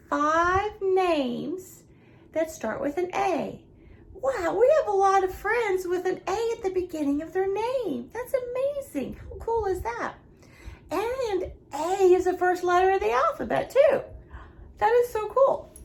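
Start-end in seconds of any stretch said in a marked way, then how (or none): 4.1-15.7 delighted teacher, high and emphatic; excited praise. Real, not acted. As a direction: marvel at it brightly, pitch lifted. Teacher cheering the class's count.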